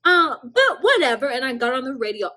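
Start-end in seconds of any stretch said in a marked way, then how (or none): 0.0-1.3 much higher, louder "Mm, definitely not"; sassy dismissal. Retold or imitated. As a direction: shut him down with sass. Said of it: "I said".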